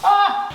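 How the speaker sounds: high excited squeal. Real, not acted. Excited squeal about moving.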